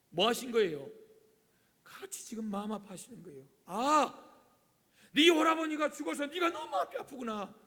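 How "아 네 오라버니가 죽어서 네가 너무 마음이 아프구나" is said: loud, high, theatrical condolence; acted sympathy. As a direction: exclaim in sympathy to a grieving sister. Acts out consoling the bereaved sister. (과장되게 큰소리로 위로하듯)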